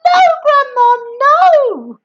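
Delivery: high, loud refusal; boy refusing Grandma; pleading shout. Acted. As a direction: shout no in alarm. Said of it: "George called back".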